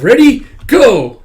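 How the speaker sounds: high, loud ready and go; loud starter's call; start call. Retold or imitated. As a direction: yell the start signal. Calling start of throw.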